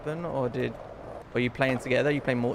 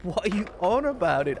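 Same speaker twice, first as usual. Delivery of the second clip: higher, baffled, what-are-you-on-about; baffled disbelief; 6 semitones higher than usual. Real, not acted. Gamer baffled by the AI's dodge, incredulous. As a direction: sound completely lost by his answer.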